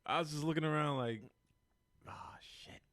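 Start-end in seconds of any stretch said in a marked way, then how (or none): 2.1-2.8 voiceless, very soft whisper; whispered dismay. Acted. Rider looking around the train in worry, whispered dismay. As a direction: whisper it under your breath as mock alarm.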